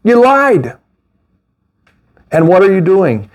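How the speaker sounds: ordinary talk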